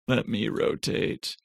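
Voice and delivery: soft, slowed muttered repeat of let me rotate; struggling with the map view, says it again softly and slowly. Real, not acted. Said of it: "Come on, let me rotate".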